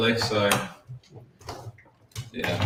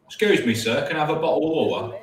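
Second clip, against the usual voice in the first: mock British accent; 6 semitones higher, faster than usual.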